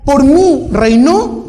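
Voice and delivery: slow, high and loud declaration; emphatic proclamation. Real, not acted. Preacher repeating the verse to the congregation, emphatic. Same phrase, repeated with force.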